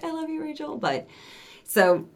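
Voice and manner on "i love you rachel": high, soft, sugary I love you; lightly acted doting line, high and soft; mock sweet voice. Imitating a friend's mock affection, high and soft. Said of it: "And it was just like".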